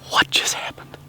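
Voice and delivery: breathless excited whisper; stunned excitement. Real, not acted. Hunter reacting to the camera right after the shot, excited whisper. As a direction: blurt it out in disbelief.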